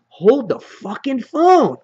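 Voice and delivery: hammed-up astonished exclamation; stunned villain. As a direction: draw it out like the door just clicked open. Negan reacting as cage opens.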